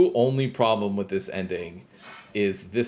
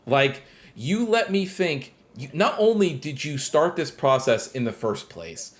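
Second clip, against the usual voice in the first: annoyed rant; 6 semitones higher than usual. Real, not acted. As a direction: vent the irritation, voice pushed up high. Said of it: "the only thing that pissed me off".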